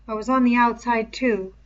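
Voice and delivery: ordinary delivery, nothing marked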